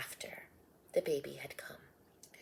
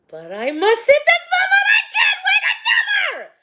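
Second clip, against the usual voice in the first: very high, loud cry of labor pain; shout; 16 dB louder, faster than usual. Acted. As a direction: cry out, the baby is coming now. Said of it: "Mary let out a shout".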